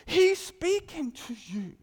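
loud, high, slow declaration; jumping-out proclamation. Shown as a demonstration. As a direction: proclaim it so the spirit jumps. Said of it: "your spirit just jumps".

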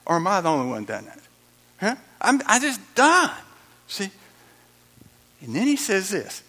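ordinary talk, nothing marked

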